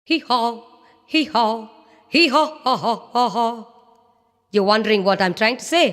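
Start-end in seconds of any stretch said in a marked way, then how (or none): 0.0-3.7 sing-song hee haw braying; donkey bray. Acted.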